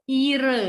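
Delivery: "ire" with a long, loud first I and no H; silent H. Shown as a demonstration. Teacher giving an example of silent H, long I in "ire". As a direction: hold the I in "ire", leave H silent. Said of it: "hanya itu silent".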